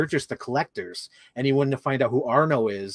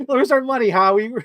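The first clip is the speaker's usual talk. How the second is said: higher, louder mock thug demand; 9 semitones higher, 6 dB louder than usual. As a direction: bark it like a debt collector. Twins threatening Howie, retold.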